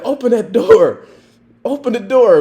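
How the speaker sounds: loud, high laughing shout; yelling order. Retold or imitated. Officer to drunk on the couch, loud laughing yell. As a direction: bellow it while cracking up.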